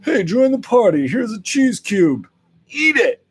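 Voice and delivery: high, loud mock-friendly invitation; overdone welcoming party-goer; pushy party host. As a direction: call out high and insistent. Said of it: "someone who's like".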